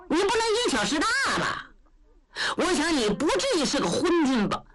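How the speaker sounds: 嗓门偏高, 快嘴憨直的糊涂老将, 理直气壮; 像个大大咧咧的老粗跟皇上讲歪理; 焦廷贵. Acted. 说书人扮焦廷贵向皇上进言. (高嗓门、语速快的憨直老将)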